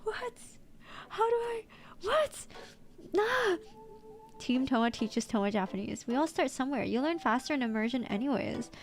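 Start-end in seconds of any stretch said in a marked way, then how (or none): 0.0-3.6 baffled. Real, not acted. Baffled reaction.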